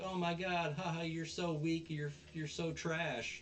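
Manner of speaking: mocking taunt. Girl taunting Onizuka in retelling.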